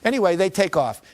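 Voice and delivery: normal talking voice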